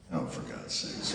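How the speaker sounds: low, slow, fed-up sigh of words; a mock fed-up mutter; exasperated groan. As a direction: grumble it under your breath in disgust. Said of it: "you're like".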